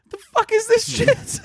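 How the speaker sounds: high, laughing "what is this"; laughing disbelief. Retold or imitated. Podcaster mocking a pointless clock, high and laughing. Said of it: "and I was just like".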